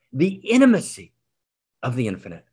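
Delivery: normal talking voice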